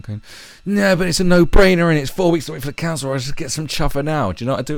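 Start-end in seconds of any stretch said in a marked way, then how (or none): 0.6-4.3 higher, fast, mocking whine; hammed-up wheedling excuse; mock whiny reply. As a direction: play the friend as a petulant addict.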